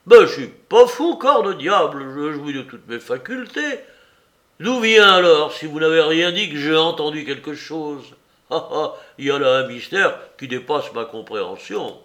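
aigu et très fort au début puis retombe; protestation affolée. Acted. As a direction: crie qu'il n'est pas fou. (Soupe bouleversé clame sa raison, crié puis apaisé)

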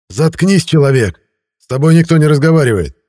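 loud, high furious yell; angry shout. Acted. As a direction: bark it at him. Henchman yells at Miron.

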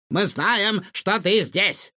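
high, taunting villain's call. A narrator's reading. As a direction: call out to someone in hiding, stretching the end. Villain calls out hidden professor. (громкий оклик злодея)

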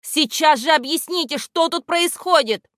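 high, loud stern demand; stern shout. A narrator's reading. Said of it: "продолжал строгим голосом кричать".

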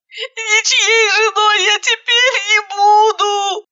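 high, slow whining wail; whimpering. A narrator's reading. Bride whimpering about her ruined wedding, high and slow. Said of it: "скулила она".

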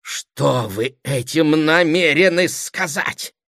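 high, slow, measured demand; angry interruption. A narrator's reading. Brother flushing at his sister's hint, angry interruption. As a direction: draw out the question indignantly. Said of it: "прервал весь покраснев".

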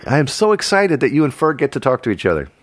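usual speaking voice

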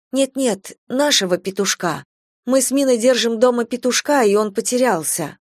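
flustered customer setting him straight; anxious explaining. A narrator's reading. Worried customer explains lost pet.

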